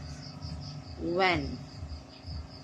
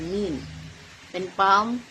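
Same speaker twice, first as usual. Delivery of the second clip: ordinary talk, nothing marked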